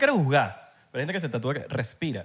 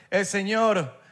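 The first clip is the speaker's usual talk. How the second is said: ordinary talk, nothing marked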